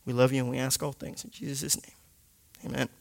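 ordinary delivery

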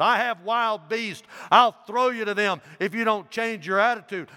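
high, loud threat; threatening. A narrator's reading. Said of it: "The proconsul said".

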